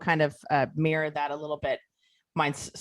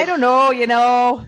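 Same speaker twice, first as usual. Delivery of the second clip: put-on a South Dakota accent, higher and louder; mock South Dakota accent, pitched up and louder; 6 semitones higher, 7 dB louder than usual. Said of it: "the South Dakota just came out".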